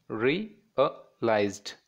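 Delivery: "realized" very slowly as re... a... lized; long pauses between the syllables of "realized"; three separate syllables. Shown as a demonstration. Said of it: "it's three syllables".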